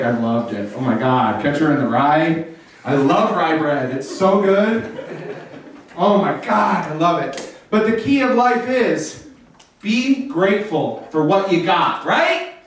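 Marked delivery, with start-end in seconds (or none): 0.0-7.5 low, gushing fake enthusiasm. Faking delight to a friend who asks about the book, gushing. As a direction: gush like you loved the book. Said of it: "You can be like".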